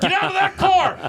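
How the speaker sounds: loud laughing mock order out of the car; laughing shout. Co-host yelling at imagined cops through laughter.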